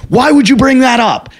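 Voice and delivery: high, exasperated reproach; retold scolding. Club manager to comic in the car, exasperated scolding imitated.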